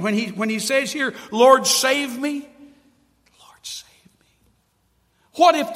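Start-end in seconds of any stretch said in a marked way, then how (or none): 1.3-2.5 louder, higher, drawn-out plea; exclaimed plea. Acted. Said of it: "there's an exclamation point". 3.4-4.3 very soft whisper; whispered plea. Acted.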